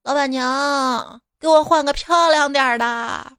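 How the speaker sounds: put-on drawn-out drunken call to the madam; drunk man. Drunk husband ordering his mother-in-law about, drunken call. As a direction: call out in a long, loose drunk drawl. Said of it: "一个哥们儿喝醉了".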